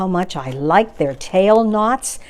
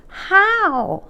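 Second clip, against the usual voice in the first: a high little troll plea; pleading trolls; 12 semitones higher than usual. A narrator's reading. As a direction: ask it like a lost troll begging to understand. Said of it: "they pleaded".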